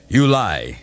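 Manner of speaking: grating. A narrator's reading. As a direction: spit the charge out harshly. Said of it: "his voice grating".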